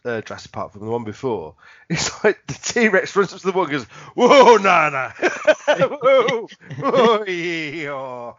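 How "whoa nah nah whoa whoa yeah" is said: loud startled whoa, then shrug; a dinosaur chickening out; T-Rex backing off. Acted. T-Rex voiced refusing at the wall.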